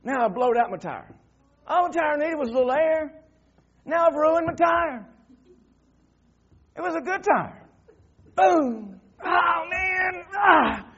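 loud, high-pitched acted wailing; hammed-up howl of frustration; mock exasperation. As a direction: cry out over a ruined tire. Acts out his blowout frustration.

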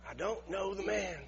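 soft, subdued restatement of the denial; quiet denial. Acted. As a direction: drop the volume and deny it softly. Same denial repeated after the shouts.

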